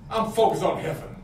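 ordinary delivery

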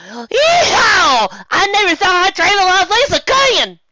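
high, loud whooping cheer; joyful yeehaw shout. Acted. Megan on the flying chariot, whooping loud and high. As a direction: whoop it out in delight. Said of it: "she shouted".

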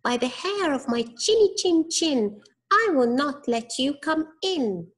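high squeaky pig voice; little pig voice. A narrator's reading. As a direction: squeak it defiantly like a piglet. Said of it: "said the little pig".

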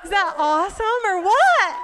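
loud, high exultant shout; real excitement. Real, not acted. Speaker asks the church to share her joy, exultant. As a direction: throw it out to the room, thrilled.

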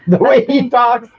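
high, slow drawled Southern voice; Southern accent. Retold or imitated. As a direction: stretch it out in a put-on Southern twang. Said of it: "southern edition of that".

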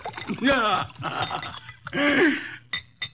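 high amused chuckling laugh; satisfied laugh. Acted. Detective laughs at the outwitted killer, amused.

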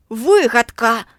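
loud snarled retort; barked. A narrator's reading. William barking at Margo, loud snap. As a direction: snap it down hard. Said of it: "гаркнув Вільям".

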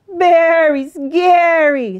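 loud, high, quavering sheep voice; frightened sheep. A narrator's reading. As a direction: stretch "very scary" out like a scared bleat. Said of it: "the sheep muttered".

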